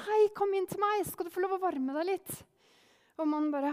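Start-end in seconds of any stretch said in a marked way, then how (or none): 0.0-2.4 high, loud call from the doorway. A narrator's reading. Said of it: "og så ropte". (Martin roper fra døra til den gamle mannen, høyt og lyst)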